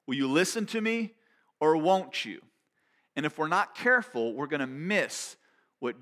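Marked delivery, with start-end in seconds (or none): 0.0-2.4 raised, pressing voice of Jesus; Jesus calling out. Retold or imitated. Jesus asking people to listen, raised insistent voice. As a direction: challenge them directly, higher and louder. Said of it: "'cause Jesus is saying".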